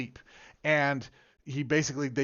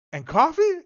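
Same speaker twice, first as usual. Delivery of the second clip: much higher, louder, teasing ask; high coaxing question; 9 dB louder than usual. Retold or imitated. Sister quizzing her bedridden dad, high and louder. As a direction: lift it up like coaxing a child.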